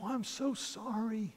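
high, drawn-out "so sorry"; remorseful apology. Retold or imitated. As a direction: apologize slowly in a high, contrite voice. Man realizes and apologizes.

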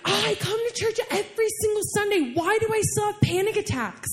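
high-pitched, exasperated protest; frustrated complaint. Acted. Churchgoer asking why the struggle continues, acted frustrated complaint.

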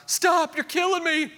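very high, acted kid's shriek; child's yell. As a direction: yell it out like a child in mock distress. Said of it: "one of your children yell out".

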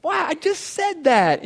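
the last line slow and pointed; a parent who can't believe it; exasperated disbelief. Retold or imitated. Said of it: "I'm like".

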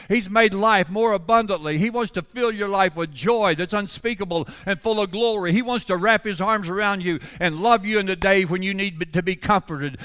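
high, pressing sermon voice, building; impassioned preaching. Real, not acted. Preacher assures congregation of God's love, building in fervour. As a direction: drive it home to the congregation.

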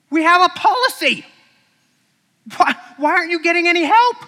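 high, loud indignant question; scolding disbelief; exasperated question. Acted. Shepherd scolding the boy about the sheep.

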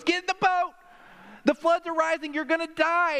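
high-pitched boatman's rescue call; acted-out cry of warning; urgent calling out. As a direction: call urgently across the floodwater. Boatman calling to flood victim.

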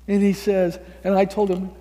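ordinary delivery

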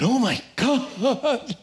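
high, slow, drawn-out gasp of dread; frightened cry. Acted. Atheist on his back before the bear, terrified.

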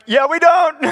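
high, loud mock-exasperated yell; fed-up dad confirming the internet is out.

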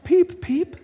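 very high tiny peeping chick; duckling peep. Acted. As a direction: squeak it like a baby bird. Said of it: "the duckling said".

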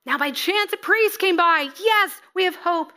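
high, loud burst of hope; the beaten man sees rescue coming; hopeful victim. Acted. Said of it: "What? You're the priest".

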